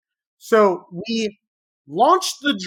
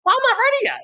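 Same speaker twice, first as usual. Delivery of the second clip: much higher, mocking voice for the friend; much faster than usual. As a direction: squeak out the friend's excuse. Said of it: "he's like".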